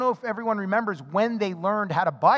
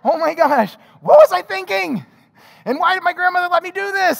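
high, loud regretful outcry; loud, high panic at your own bad choice; dismayed cry; 8 semitones higher, 6 dB louder than usual. Boy left behind by friends cries out high and loud. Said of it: "And I'm like".

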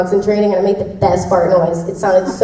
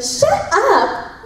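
high, teasing mock outburst; playful shut up; 14 semitones higher than usual. Actor teasing a smiling castmate in the booth. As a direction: snap it out in fun. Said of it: "you're like".

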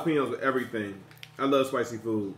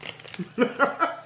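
unvoiced high laugh; breathy laugh; 8 semitones higher than usual. Real, not acted. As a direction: laugh at the heat. Reacting to the spicy chip.

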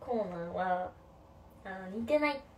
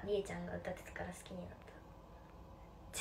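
whisper; 5 semitones lower, 10 dB quieter than usual. Real, not acted. Reading comment quietly.

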